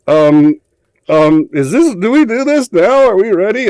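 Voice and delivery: high, hesitant nervous stammer; flustered panic. Retold or imitated. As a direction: caught off guard and not ready, squeak it out. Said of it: "I was like".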